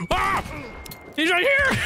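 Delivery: sudden high startled yelp; jump scared. Real, not acted. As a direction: yelp in real fright. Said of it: "he jump scared me".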